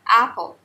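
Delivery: apple with an open American a, stressed on the first syllable; correct American apple. Shown as a demonstration. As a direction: model apple clearly for the class. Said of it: "It's pronounced as apple".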